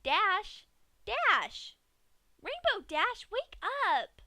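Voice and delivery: high, loud calls to wake her; calling out. Acted. Pinkie yells to wake the delirious Dash, high and loud. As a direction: snap her awake with loud cheerful calls. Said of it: "tried to snap ... back to attention".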